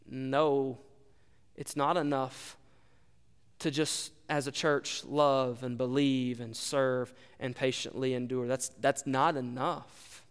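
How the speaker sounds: low, quiet, slow voice of Jesus; Jesus answering. Acted. Preacher voicing Jesus telling the church it's not enough, low and hushed. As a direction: drop low and soft, as a grave final verdict.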